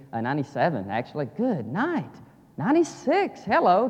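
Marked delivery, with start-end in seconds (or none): 1.4-3.9 jumps high in surprise at his own slip; a playful high yelp of self-correction; mock surprise at himself. Real, not acted. Catching his own wrong year.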